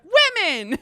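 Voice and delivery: high, loud exclamation mimicking the film; mock rallying cry. Podcaster summing up the film's message, mock triumphant cry. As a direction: shout it like a hammed-up slogan.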